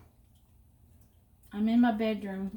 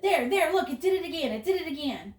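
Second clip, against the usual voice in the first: higher, insistent mock exclamation; imitated excited dad; 7 semitones higher, 4 dB louder than usual. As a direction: act out dad proving his point. Retold dad pointing out glitch.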